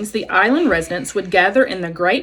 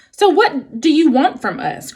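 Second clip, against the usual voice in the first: growling fox; 6 semitones higher, 3 dB louder than usual. A narrator's reading. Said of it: "growled Fink".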